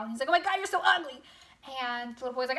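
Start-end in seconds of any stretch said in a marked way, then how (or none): 0.3-1.2 high, loud mock horror; mock freak-out. Little boy retold shrieking at the monster, mock freak-out. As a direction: exclaim in exaggerated alarm, stretching the insult. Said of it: "freaks out".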